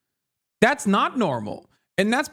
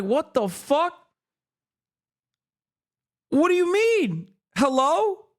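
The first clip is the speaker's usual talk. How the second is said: high, incredulous what the fuck; stunned disbelief; 7 semitones higher than usual. Retold or imitated. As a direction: sound floored by the comments. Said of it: "I was like".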